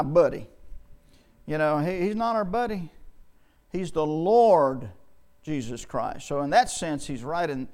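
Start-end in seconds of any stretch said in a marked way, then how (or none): 3.7-4.9 "Lord" stretched out slowly; slow down and hold the word "Lord"; stress on LORD. Real, not acted. Preacher correcting a too-familiar view of Jesus, stressing "Lord". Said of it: "stressing the fact that he's Lord".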